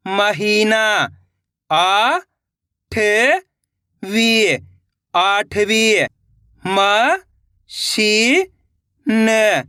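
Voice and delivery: each syllable drawn out on a rising pitch, then the whole word; syllable drill. Shown as a demonstration. Teacher sounding out Hindi syllables for young readers, drawn out. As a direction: sound out syllables slowly for beginners.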